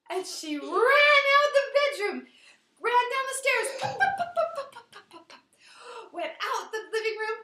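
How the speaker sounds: loud high storytelling chase; excited frantic narration. A narrator's reading. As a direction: race through the escape.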